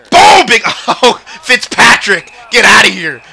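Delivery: high, loud burst of joy; excited shout. Real, not acted. Gamer hyped by a big play, loud cheer. Big save or hit celebration.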